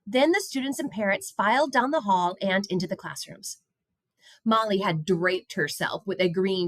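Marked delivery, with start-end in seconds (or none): none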